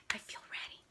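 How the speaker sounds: hushed, almost silent; whisper. A narrator's reading. The boy on the diving board, whispering. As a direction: breathe the words out softly. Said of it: "he says".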